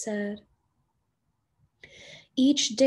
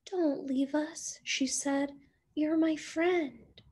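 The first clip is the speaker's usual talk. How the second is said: softer, higher, half-whispered plea; sad little penguin; 6 semitones higher, 5 dB quieter than usual. Acted. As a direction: beg quietly, like a small friend about to cry. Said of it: "Little Penguin looked sad".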